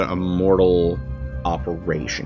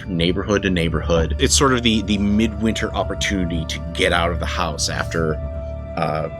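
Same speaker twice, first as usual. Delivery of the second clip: story narration; 6 semitones lower than usual. Acted. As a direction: set the scene like a storyteller. GM opens the vampire story.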